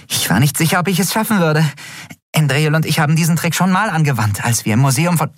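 high little creature's chatter; excited chatter. A narrator's reading. Nelen explains her trick to Keru, chattering. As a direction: rattle it on, pitched high. Small Jadi chatters after escape.

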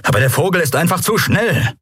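high-pitched servant's protest; high, protesting excuse; complaining. A narrator's reading. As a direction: complain defensively, voice climbing high. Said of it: "beschwerte sich Telbo".

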